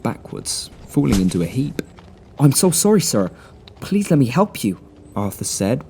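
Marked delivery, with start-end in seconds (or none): 2.4-4.8 a high, put-on Boston accent, badly faked; Boston accent overdone by a boy in disguise. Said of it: "putting on his best Boston accent".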